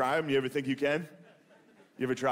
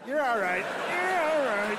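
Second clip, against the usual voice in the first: put-on higher and higher, cooing "You're all right" like to a toddler; soothing a fallen toddler; 10 semitones higher, slower than usual. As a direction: coo "You're all right" as if lifting a crying child. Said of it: "like, when a toddler falls".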